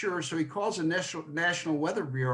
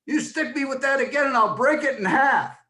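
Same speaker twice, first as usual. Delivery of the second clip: much higher, louder mock threat; angry threat; 9 semitones higher, 6 dB louder than usual. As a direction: snap back at the boy who jabs her. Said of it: "She goes".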